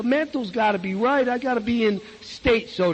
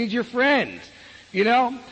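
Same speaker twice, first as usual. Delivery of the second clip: ordinary talk, nothing marked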